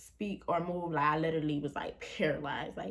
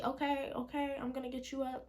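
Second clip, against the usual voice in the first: higher, lightly voiced mom reassuring; a hint of mom soothing, pitched up; mom's voice; 8 semitones higher than usual. Mom answering her daughter in the dream, lightly higher. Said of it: "And she".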